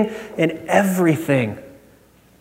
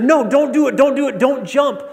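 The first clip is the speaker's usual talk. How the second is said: high, pleading rush of don'ts; mock-urgent pleading; urgent plea; 8 semitones higher than usual. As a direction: beg someone to stop, voice pitched up. Acted plea to a jumper.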